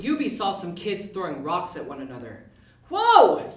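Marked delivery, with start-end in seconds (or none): 2.9-3.5 high, loud warning shout; yelled. A narrator's reading. Elf yelling at kids throwing rocks. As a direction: yell to stop them. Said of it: "Eubie yelled".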